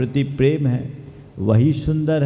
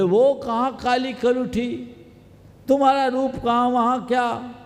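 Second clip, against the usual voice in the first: high, hurried complaint; acted protest; 9 semitones higher than usual. Suitor complains to girl about her sister, protesting. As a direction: object with a raised, rushing voice.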